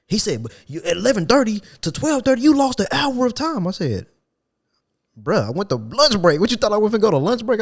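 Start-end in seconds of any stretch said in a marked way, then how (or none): 0.4-3.7 mimicking the boss, pitched way up; mocking boss voice. Boss voiced in retold argument. 5.2-7.6 retold comeback. Retold argument with boss.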